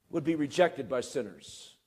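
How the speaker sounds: ordinary talk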